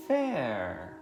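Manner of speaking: normal talking voice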